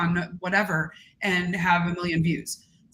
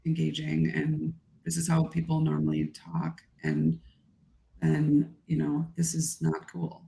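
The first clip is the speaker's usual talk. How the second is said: low, soft monotone; unengaging normal talk; 3 semitones lower, 5 dB quieter than usual. Shown as a demonstration. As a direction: talk with the energy and intonation drained out, quieter and lower. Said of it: "if I was to just talk like this".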